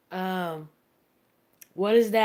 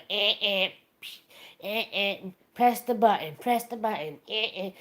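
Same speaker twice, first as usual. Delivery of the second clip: mock lazy grunting. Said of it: "All he does is sit and go".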